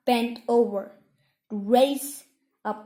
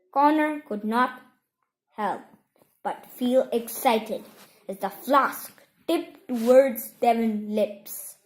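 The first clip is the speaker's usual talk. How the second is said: plain reading voice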